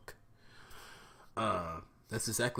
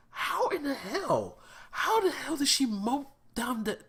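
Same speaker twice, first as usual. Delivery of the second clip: stunned disbelief; 4 dB louder than usual. Retold or imitated. Reliving watching the fight, in a high stunned squeal. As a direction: shriek like you can't believe your eyes. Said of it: "I'm like".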